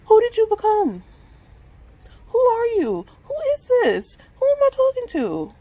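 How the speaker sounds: desperate self-confrontation rising to a scream; shouting at herself. Real, not acted. Yelling at herself in frustration.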